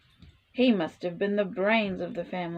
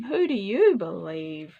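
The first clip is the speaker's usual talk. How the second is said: ordinary delivery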